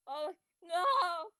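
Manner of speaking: high, hammed-up dismayed wail; drawn-out melodramatic lament; mock grief. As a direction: cry out in fake despair.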